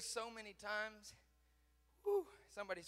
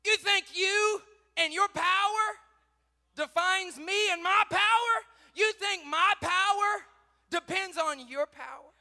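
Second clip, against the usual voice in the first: high, loud, slow challenging questions; thundering rebuke; 11 semitones higher, 18 dB louder, slower than usual. Retold or imitated. God to Joshua on his face, thundering rebuke. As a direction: rebuke in loud, drawn-out phrases.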